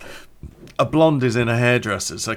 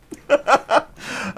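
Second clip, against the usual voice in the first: high giggling; helpless high chuckle at your own prank; laughing; 14 semitones higher, 3 dB louder than usual. Real, not acted. Podcaster enjoying the prank, giggling.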